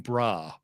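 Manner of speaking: imitating brawl said as brah, no l; mock brah. Co-host mimics how the host says brawl as brah. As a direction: brah with the l dropped. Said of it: "the way your accent just makes it sound like you're saying".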